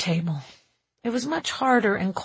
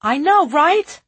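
loud, high rising reply; high, emphatic question; echoed catchphrase; 8 semitones higher, 9 dB louder than usual. A narrator's reading. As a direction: toss the phrase back in a playful echo. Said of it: "Spencer answered".

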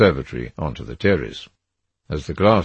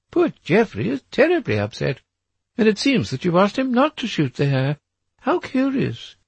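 much higher society lady's voice; a chattering duchess gossiping; lightly voiced Duchess; 4 dB louder than usual. A narrator's reading. The Duchess telling Mister Gray about the hare, much higher.

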